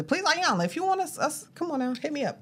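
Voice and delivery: high, teasing begging voice; playful pleading. Real, not acted. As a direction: beg jokingly, half laughing. Jokingly begging the author for sponsorship.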